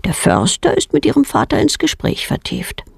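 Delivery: ordinary delivery, nothing marked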